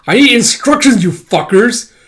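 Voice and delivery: high, loud exasperated complaint; fed-up rant. Acted. Demanding instructions from the idiot cats, loud and fed up. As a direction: snap at the useless cats. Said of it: "It's like".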